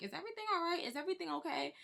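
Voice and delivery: high, worried questioning; concerned friend. Retold or imitated. People asking if she's alright.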